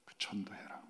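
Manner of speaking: soft voiceless whisper of the Spirit; whispered rebuke. Retold or imitated. Holy Spirit whispers a command to the pastor, hushed. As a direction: breathe the command, barely voiced. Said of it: "굉장히 책망하셨어요".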